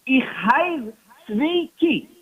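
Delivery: put-on slow, deliberate Yiddish-accented "Ich heiz zwei ki"; Yiddish phrase. Rabbi answers the nosy man, slow Yiddish. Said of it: "he says in Yiddish".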